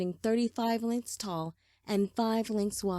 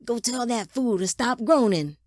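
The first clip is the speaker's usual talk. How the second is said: annoyed; 4 semitones higher, 6 dB louder than usual. Acted. Drowsy father annoyed.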